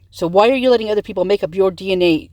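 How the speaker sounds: ordinary talk, nothing marked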